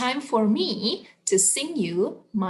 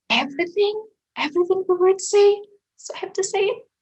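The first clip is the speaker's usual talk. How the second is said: high, fast gasping disbelief; mock shock; 10 semitones higher, much faster than usual. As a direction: act horrified at the rule. Reader shocked by the rule.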